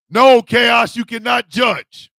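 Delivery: loud playful scold at Chaos; loud call-out. Real, not acted. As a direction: bark it at the viewer, slow. Calling out chat.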